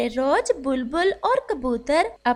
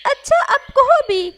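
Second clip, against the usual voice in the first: higher, louder parrot losing patience; impatient parrot; 10 semitones higher, 7 dB louder than usual. Acted. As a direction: hurry him up.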